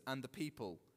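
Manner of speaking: ordinary talk, nothing marked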